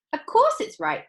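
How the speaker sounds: high, loud know-it-all; bratty girl. Acted. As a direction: brush off the warning. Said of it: "said Violet".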